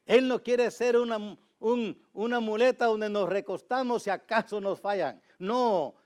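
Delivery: loud, high, fervent preaching; full-force preaching. Acted. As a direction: drive it home from the pulpit, loud and high. Preaching burst.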